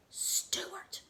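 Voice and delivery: a tiny voiceless whisper of his name; small whisper. A narrator's reading. A bird whispering to sad Stuart from behind, a small whisper. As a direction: breathe the name from behind him, small and secret. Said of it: "a small voice behind him whisper".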